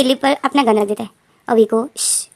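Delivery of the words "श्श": soft whispered shh; shushing. Acted. Jinkook silences V with a soft shh. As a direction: hush him, gun on his lips. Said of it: "बोलता है".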